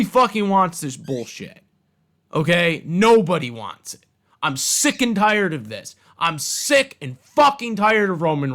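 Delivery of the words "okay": ordinary talk, nothing marked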